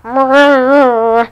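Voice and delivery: loud laugh through closed lips; burst of laughter. Real, not acted. Laughs at own joke.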